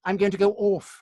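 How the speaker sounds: mock mum's RADA accent, "off" as "orf"; ridiculous posh accent. Mother announces she is leaving, affected RADA accent. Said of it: "this was a ridiculous accent".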